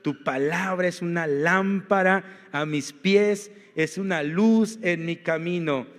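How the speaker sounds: slow, deliberate verse reading; reading a verse aloud; slow scripture reading. Acted. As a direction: read each word slowly.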